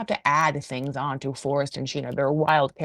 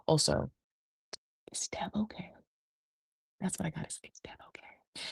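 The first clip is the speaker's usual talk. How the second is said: secretive unvoiced whisper; 2 semitones lower, 12 dB quieter than usual. [name] whispering a secret to Forrest.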